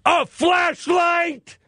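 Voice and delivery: high, slow, mocking shout; exasperated shout. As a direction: bellow it like a fed-up clerk. Comic quoting exasperated clerk.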